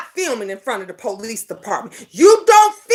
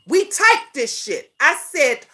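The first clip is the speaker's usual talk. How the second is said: ordinary talk, nothing marked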